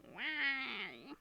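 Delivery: high, drawn-out plaintive why; mock despairing wail. Owner who stepped in cat food, mock despairing wail.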